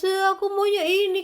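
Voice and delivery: put-on whiny child.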